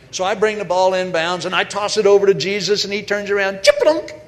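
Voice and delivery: loud, rising play-by-play peaking on the dunk; excited play-by-play. Acted. Preacher calling Jesus's dunk for the congregation, excited and loud. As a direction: call the dunk like a thrilled announcer.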